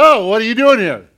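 high, loud, fast frightened cry; loud, high-pitched startled yelp; scared. Acted. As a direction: jump back in fright at the angel. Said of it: "He was scared to death".

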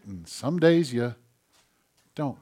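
ordinary talk

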